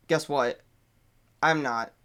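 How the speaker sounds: usual speaking voice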